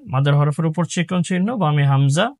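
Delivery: usual speaking voice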